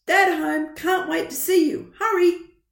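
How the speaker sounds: high, loud and eager; excited mum. Acted. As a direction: rush it out with joy. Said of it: "a message from Mum".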